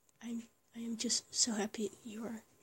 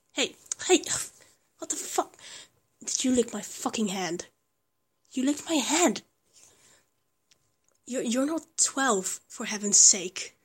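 mock outraged surprise; 6 dB louder than usual. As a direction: fake indignation, half laughing. Partner licked her hand.

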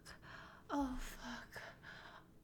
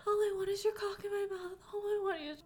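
urgent pleading; 6 semitones higher, 4 dB louder, faster than usual. Real, not acted. Urgent pleading while aroused.

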